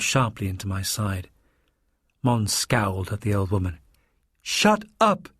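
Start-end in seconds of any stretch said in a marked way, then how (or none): 4.4-5.3 high, angry command; spat out. A narrator's reading. Mons silencing the sobbing Mother Superior, angry spitting command. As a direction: spit out each word. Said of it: "he spat".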